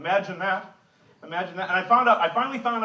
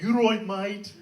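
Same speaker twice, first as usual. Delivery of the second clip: badly imitated Brummie accent; an American's shaky attempt at Brummie; terrible Birmingham accent; 4 semitones higher, slower than usual. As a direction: have a clumsy go at a Birmingham accent. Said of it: "I'm working on the accent".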